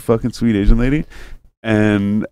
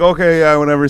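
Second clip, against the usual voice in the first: higher, louder mock shrug; dismissive agent; 6 semitones higher, 5 dB louder than usual. The call agent brushing off the caller, mock dismissive. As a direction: toss it off like a bored agent.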